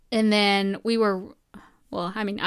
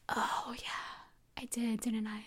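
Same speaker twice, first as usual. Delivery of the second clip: soft near whisper; quiet voice remembering the past; hushed realisation; 10 dB quieter, slower than usual. Retold or imitated. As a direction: breathe it out, barely voiced. Her own quoted realisation.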